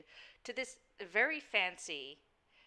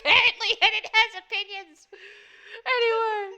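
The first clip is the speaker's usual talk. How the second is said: laughing; 12 semitones higher, 11 dB louder than usual. Real, not acted. Laughing at the barking dog.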